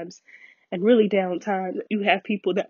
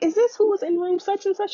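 much higher, eager questioning friend; imitating excited friend; 13 semitones higher than usual. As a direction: ask the nurse like the eager friend. Friend asks nurse, voiced high.

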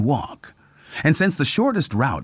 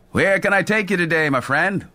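cheerful conductor voice; 7 semitones higher than usual. A narrator's reading. Conductor greets passenger.

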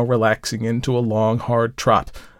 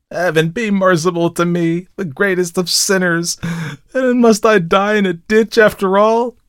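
high, desperate prayer rising louder; fear-stricken plea; 8 semitones higher, 5 dB louder than usual. A narrator's reading. Dying rider begs heaven for mercy, high and frightened. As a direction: plead for mercy in terror. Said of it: "groaned the fear-stricken man".

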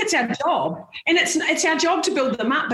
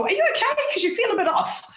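concerned check-in; 10 semitones higher than usual. Retold or imitated. Checking on friends.

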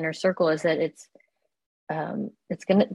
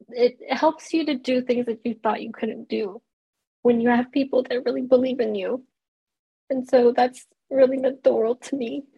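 speaking through real tears, higher; ugly crying; 5 semitones higher than usual. Real, not acted. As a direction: voice wet and high from crying. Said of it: "now I'm ugly crying".

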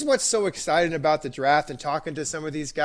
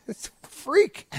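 laughing; 11 semitones higher, slower than usual. Real, not acted. Amazed at forty time.

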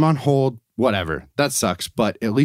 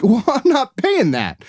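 indignant refusal; 10 semitones higher than usual. Retold or imitated. Customer refusing a charge in retold call.

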